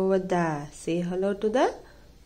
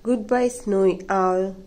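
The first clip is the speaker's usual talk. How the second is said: ordinary delivery, nothing marked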